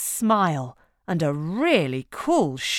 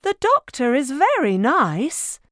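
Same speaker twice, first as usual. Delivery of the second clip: high, gentle soothing mother; reassuring mum; 7 semitones higher than usual. A narrator's reading. Mum reassuring Splat about doctors, gentle. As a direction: comfort a nervous child.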